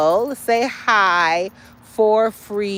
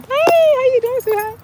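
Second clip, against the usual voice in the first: much higher, louder falsetto hello; high sing-song greeting; 7 dB louder than usual. Acted. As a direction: chirp it up high like greeting a darling.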